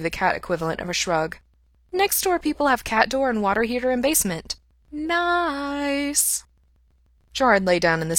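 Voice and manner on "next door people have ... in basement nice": higher, terse smug cat voice; a cat pleased with its find; cat boasting about the heated basement. A narrator's reading. Cat Mopsy explains.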